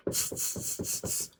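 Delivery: soft voiceless "tss" sounds; monkey chatter. A narrator's reading. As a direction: imitate monkeys with little tongue-and-teeth noises. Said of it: "and said".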